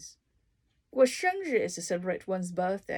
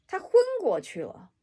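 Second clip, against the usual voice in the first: dramatic example; 13 semitones higher, 7 dB louder than usual. Shown as a demonstration.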